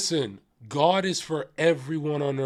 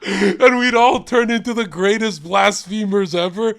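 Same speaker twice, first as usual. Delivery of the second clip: ordinary talk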